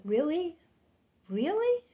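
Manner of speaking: high, rising disbelief; incredulous. Retold or imitated. Disbelief at coworker's claim.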